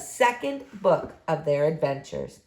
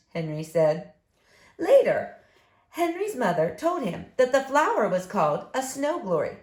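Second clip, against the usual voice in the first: plain reading voice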